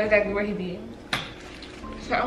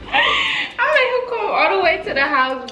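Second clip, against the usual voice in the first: laughing shout; 10 semitones higher than usual. Real, not acted. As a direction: shout it while cracking up. Repeats punchline, cracking up.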